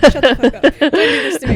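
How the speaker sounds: loud, high playful yell of protest; mock outraged shout. Teasing her co-host with a mock outraged shout. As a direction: shout it in fake exasperation.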